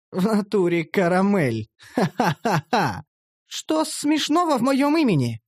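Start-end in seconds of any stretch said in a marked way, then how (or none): 1.9-3.0 slow mocking ha-ha-ha; slow laugh. Master laughs at the slave's name Caramel, slow and mocking. 3.5-5.4 put-on high indignant elf girl voice; offended elf girl. Elf girl demands why her name is funny, high and offended.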